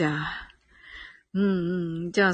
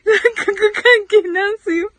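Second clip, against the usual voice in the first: higher and louder, cracking up mid-phrase; laughing; 7 semitones higher, 6 dB louder than usual. Real, not acted. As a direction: blurt it out while bursting into laughter. Laughing at a viewer comment.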